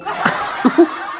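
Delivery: soft airy laughter; breathy laugh. Real, not acted. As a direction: laugh quietly under breath. Laughing at friend's confusion.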